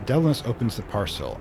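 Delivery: ordinary talk, nothing marked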